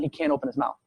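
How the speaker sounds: normal talking voice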